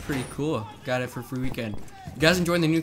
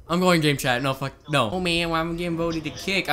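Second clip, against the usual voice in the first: ordinary talk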